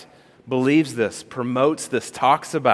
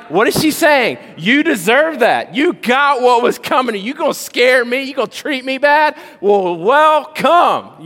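gloating daughter; 11 semitones higher, 6 dB louder than usual. Retold or imitated. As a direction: rub it in like a cheeky child. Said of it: "She's like".

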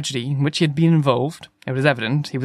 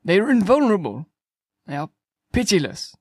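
higher-pitched lament; despairing; 5 semitones higher than usual. A narrator's reading. Curate despairs of beating the Martians, plaintive. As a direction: dwell hopelessly on each verdict.

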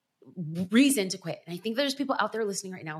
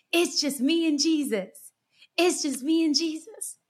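higher, louder, joyful calling out of the hook; excited call; 7 semitones higher, 3 dB louder than usual. Retold or imitated. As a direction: call it out like a happy reunion. Sharon calls out the hook.

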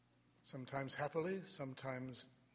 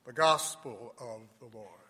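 solemn Gospel proclamation; cathedral echo; 6 dB louder than usual. Acted.

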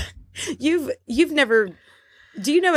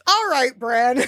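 all-caps exasperation; slower than usual. Acted. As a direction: put it in capitals, half-shouted. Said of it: "I just wrote in all caps".

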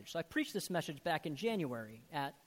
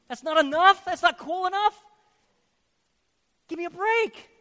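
loud, high sarcastic scoff; mock scorn; 12 semitones higher, 15 dB louder than usual. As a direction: scoff at the complaint. Mocking the need for novelty.

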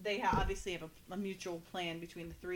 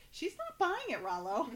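high-pitched comic relay of a line; playful high-voiced imitation; mock echo; 8 semitones higher than usual. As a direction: parrot it back much higher, dropping on the name. Retelling the echoed line, laughs after.

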